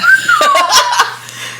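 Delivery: high shrieking laugh; real high-pitched laugh; squealing laugh. Real, not acted. Big laugh after impression.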